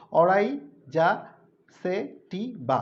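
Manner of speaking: অরাইজা সেটিবা said slowly, part by part; split into syllables. Shown as a demonstration. Teacher breaking a scientific name apart for students, slowly. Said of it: "ভেঙে ভেঙে বললে সুবিধা হয় যে উচ্চারণ করতে".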